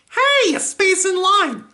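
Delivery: very high, excited cartoon call; character voice. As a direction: pipe up in a high, loud voice. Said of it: "doing the voices and the lines".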